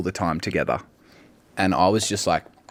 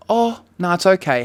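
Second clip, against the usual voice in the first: high-voiced polite refusal; 10 semitones higher, 5 dB louder than usual. Blind diner politely declines menu.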